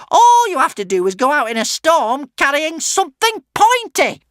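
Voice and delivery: very high, loud witch tantrum; raging witch. A narrator's reading. Witch scoffs at harnessing thunder, raging. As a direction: rant in outrage, voice way up. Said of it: "raged the witch".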